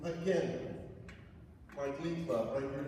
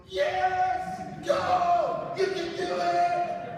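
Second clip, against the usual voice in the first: high, loud cheering shout; cheering grandma; 12 dB louder, much slower than usual. Retold or imitated. Little grandma in the crowd cheering loudly. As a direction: cheer him on like an excited grandma. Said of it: "And she was like".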